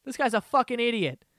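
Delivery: loud jeering laugh at an idiot; mocking laugh. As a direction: jeer like a heckler. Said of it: "love going".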